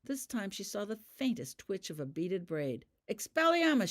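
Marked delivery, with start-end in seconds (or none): 3.1-3.9 loud, high shouted incantation; shouted spell. A narrator's reading. Holly casting at Ravenda, shouted spell. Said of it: "she shouted".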